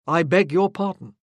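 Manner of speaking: offended officer coldly repeating his apology; stiff apology. A narrator's reading. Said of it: "he said again stiffly".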